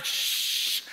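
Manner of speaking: long, sharp "shhh"; drawn-out hissed hush; urgent shushing. Acted. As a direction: shush someone to keep it secret. Hushes the clerk again.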